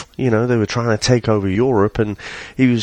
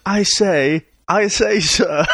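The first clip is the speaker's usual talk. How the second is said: put-on higher-pitched, hammy English accent; mock a hammed-up English accent; lightly parodied bad English accent, calling to a coachman; Keanu Reeves; 8 semitones higher, slower than usual. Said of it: "Get him to do a fucking English accent".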